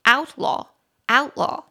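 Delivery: teacher modelling outlaw，outlaw stress for learners; slow stress demo. Shown as a demonstration. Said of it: "中音节比较高的音节在第一音节".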